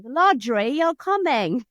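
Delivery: ladri said with Italian vowels and rolled r; Italian accent. Retold or imitated. Child speaking English with an Italian-accented word. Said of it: "but just add the accent".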